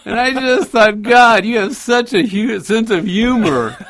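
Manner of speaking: talking through laughter, high and loud; can barely get it out for laughing. Real, not acted. Retelling the snake moment to the co-host, laughing hard.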